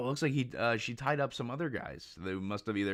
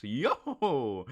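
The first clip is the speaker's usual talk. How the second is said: ordinary talk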